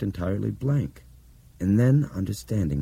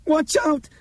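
very high-pitched alarmed warning; sharp cry; 3 dB louder than usual. Acted. Dinah warns the others of danger, sharp cry. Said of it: "Dinah cried sharply".

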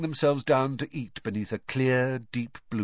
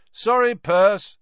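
loud, high, drawn-out apology; grinning; 10 semitones higher, 9 dB louder, slower than usual. A narrator's reading. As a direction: call it out cheekily with a grin.

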